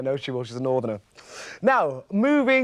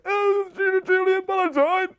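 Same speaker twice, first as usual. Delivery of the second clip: much higher, drawn-out mock version of her voice; imitating a colleague; 11 semitones higher than usual. Presenter retelling a colleague's imitation of her, high mock voice. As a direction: send up her on-air voice, high and sweet. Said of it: "imitating my voice".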